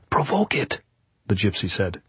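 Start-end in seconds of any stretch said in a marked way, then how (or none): 0.1-0.8 urging. A narrator's reading. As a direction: press it in a raised pitch.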